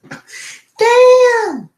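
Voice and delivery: piercing falsetto outburst, long stretched exclamations; Chris Tucker. Acted. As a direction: screech it out at the top of your range. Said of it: "I could do Chris Tucker".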